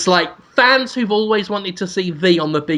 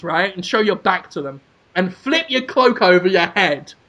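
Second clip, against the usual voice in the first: usual speaking voice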